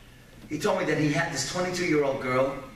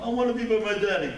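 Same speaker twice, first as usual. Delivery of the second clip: higher, quick, mock-crying child's plea; retold tearful boy; 6 semitones higher, 3 dB louder, faster than usual. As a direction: whine it like an upset little boy. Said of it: "he starts crying".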